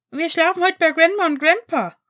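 put-on high, loud child voice; excited announcement. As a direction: announce it like an excited little boy. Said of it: "verkündete Elliot".

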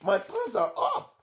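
high squeaky mouse voice; tiny squeaky mouse. A narrator's reading. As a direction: squeak it out happily. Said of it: "cried the old mouse".

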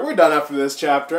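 rushed, fast mutter of the same line; fast, hurried repeat; quick repeat. Real, not acted. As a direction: rattle it off fast.

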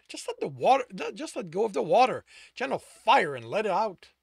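loud, high-pitched urging; exasperated. A narrator's reading. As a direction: push them hard, out of patience.

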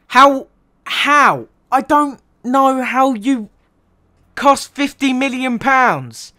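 high, slow, incredulous how; exasperated disbelief. Real, not acted. Ranting fan asks Sterling how he cost that much, exasperated. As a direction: drag out the disbelief.

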